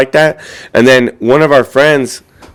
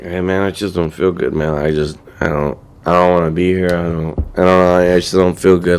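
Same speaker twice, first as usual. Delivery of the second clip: low, soft, sickly mumble; retold feeling unwell; 6 semitones lower, 5 dB quieter than usual. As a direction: imitate a friend too unwell to go on. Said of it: "He's not feeling so well".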